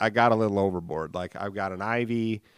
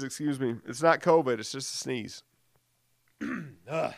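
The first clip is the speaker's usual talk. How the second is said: usual speaking voice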